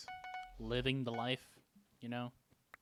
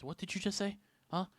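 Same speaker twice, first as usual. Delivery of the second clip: higher-pitched confrontational demand; hostile; 7 semitones higher than usual. Retold or imitated. Stranger getting in the storyteller's face, hostile. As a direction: imitate a hostile challenge. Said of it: "starts to get extremely hostile with me".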